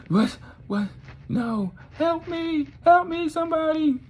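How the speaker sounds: very high, panicked cries for help; freaking out. Acted. Mandy comes to facing her killer, high panicked squeal. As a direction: squeal it in acted terror. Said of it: "She freaks out".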